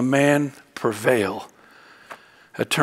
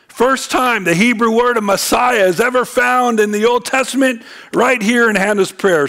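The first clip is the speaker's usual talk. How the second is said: high, punchy pulpit teaching; emphatic preaching; 9 semitones higher, 5 dB louder than usual. Acted. Preacher stressing Messiah's first mention to congregation, emphatic preaching. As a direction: drive the point home in a raised voice.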